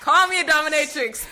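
shouting through laughter; laughing shout. Real, not acted. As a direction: yell it while cracking up.

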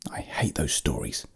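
soft, low whisper; very quiet, whispered dislike; whispered interruption. A narrator's reading. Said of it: "Gregor whispered through the door".

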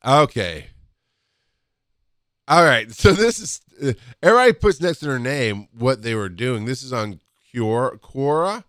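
ordinary talk

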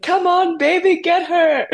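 put-on high, loud yell in a French accent; Caroline's French accent yell. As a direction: cheer them on in a French accent. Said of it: "in her French accent".

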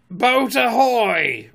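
long, loud, high hail; drawn-out pirate captain's bellow; Captain Hook. A narrator's reading. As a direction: ring it out across the water. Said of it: "rang over the lagoon in Hook's voice".

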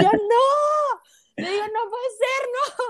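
very high drawn-out mock squeal; retold disbelief. Fan retelling her reaction to news, squealed disbelief. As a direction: squeal it in dramatized shock.

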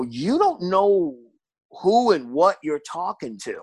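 slow, menacing threat; menacing warning. Retold or imitated. Comedian retells warning a heckler's boyfriend, slow menace. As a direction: let him know who he's dealing with. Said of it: "I go".

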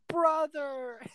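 high, overjoyed shout of recognition; a sister suddenly recognizing her brother, half in tears; joyful cry of surprise. Retold or imitated. Sister surprised, tearful reunion.

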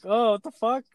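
high, shocked "what the"; high-pitched retold shock; shocked outburst. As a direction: recoil in disbelief. Shocked at poop on floor.

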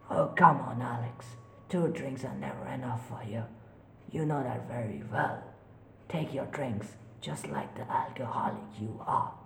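soft, cruel taunting voice; ghostly whisper. Acted. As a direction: whisper the insult. Voices mocking Alex.

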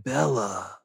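low, soft, lifeless mock Edward; emo excitement. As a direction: sigh the name out with no energy. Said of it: "his excitement is hilarious because it's also emo".

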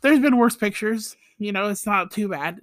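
ordinary talk

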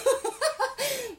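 can't stop laughing; high laughter. Real, not acted. Laughing at own impression.

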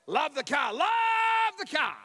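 high, hammed-up shouted praise; over-enthusiastic. Customer softening bad news for salesmen, over-enthusiastic. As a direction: gush loudly, stretching the repeat.

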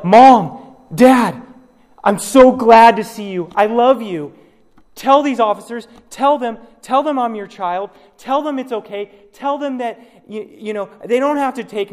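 high, loud pleading cries to the parents; desperate yell. Pretend. Child begging parents before police, high and loud. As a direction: yell for mom and dad to save you. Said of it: "And you yell".

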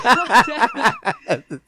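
laughing exasperation. Real, not acted.

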